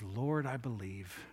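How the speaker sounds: slow, low, hushed declaration; reverent confession. Acted. Healed man answering Jesus, hushed reverent belief. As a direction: say it softly and slowly, in humble belief.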